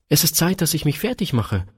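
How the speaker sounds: put-on a small girl hurrying off; eager little girl. A narrator's reading.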